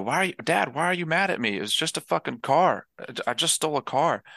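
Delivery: high, whining son, lightly voiced; a pouting kid whining to dad; whiny son. Son whines to his mob boss father, high and aggrieved. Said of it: "And he's like".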